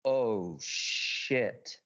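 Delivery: low, drawn-out, half-voiceless oath; stunned dread. Retold or imitated. Standing as his brother charges at him, low stunned dread. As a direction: let it sink into a low breath. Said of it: "Like that".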